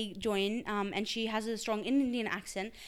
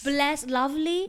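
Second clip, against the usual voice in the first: drawn-out, higher and louder British lilt; British accent; 5 semitones higher, 6 dB louder, slower than usual. Shown as a demonstration. Showing off a mannerism brought back from London, British accent. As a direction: stretch it out in borrowed British. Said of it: "But I came back with this".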